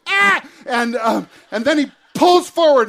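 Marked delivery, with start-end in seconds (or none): 0.1-0.5 short high shriek of fright; a sharp squeal as the car thumps; retold scream. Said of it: "the girls in the back scream".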